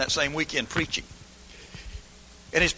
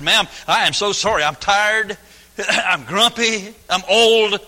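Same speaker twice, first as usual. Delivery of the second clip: mildly hammed-up pleading, louder and higher; begging apology; 5 semitones higher, 6 dB louder, slower than usual. Embarrassed guest begging the maid's forgiveness, hammed-up apology. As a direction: plead for forgiveness, drawing out each excuse. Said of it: "begging her to forgive me".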